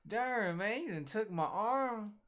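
lightly voiced sulky loser; mock whining victim. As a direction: whine like you just lost an arm. Voicing the armless victim.

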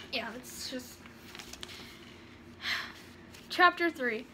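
ordinary delivery, nothing marked

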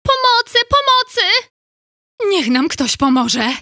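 high, loud cries from afar; high distant shouts for help; calling for help. Acted. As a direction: call out for rescue.

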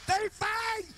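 high, loud yelled announcement; shouted announcement. Retold or imitated. Neighbors shouting out to announce the fight. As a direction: holler it out to the whole block.

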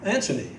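"Anthony" softly, with a T for TH; low, quiet practice of "Anthony" with T; trying stage pronunciation. Shown as a demonstration. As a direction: tentatively try "Anthony" as AN-tuh-nee. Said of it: "Just like that?".

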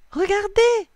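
high, loud excited call. A narrator's reading. Noah points out pedal boats to the cousins, excited call. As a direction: call out high to get everyone looking. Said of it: "lance Noah".

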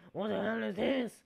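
ordinary delivery

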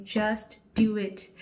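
loud, slow Just do it; slow emphatic slogan. Real, not acted. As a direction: drive each word of the slogan. Slogan advice.

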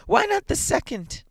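very high, loud pleading question; frantic old woman's cry; feverish. A narrator's reading. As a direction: demand it feverishly at top pitch. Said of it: "said the old woman feverishly".

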